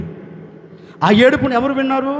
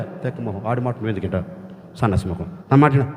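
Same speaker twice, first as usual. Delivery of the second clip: lower, softer, tender scolding; gentle as a man; 11 semitones lower, 5 dB quieter, faster than usual. Acted. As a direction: comfort her like a close friend. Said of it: "ఒక మనిషి ఎలా మాట్లాడుతున్నాడు". (దేవుడు రాహేలును మనిషిలా మృదువుగా ఓదార్చడం)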